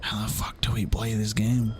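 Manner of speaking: hushed swearing whisper. Real, not acted. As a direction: whisper it under your breath. Whispered aside to stream.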